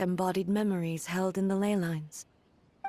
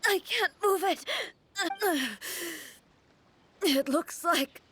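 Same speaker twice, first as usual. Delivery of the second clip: high, strained, halting speech with gasps; struggling in pain; 7 semitones higher, slower than usual. Acted. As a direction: force words out as your body fails. Shogun collapsing, body failing.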